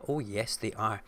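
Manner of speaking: ordinary talk, nothing marked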